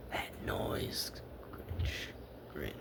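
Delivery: eager sly whisper. A narrator's reading. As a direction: lean in and whisper with glee.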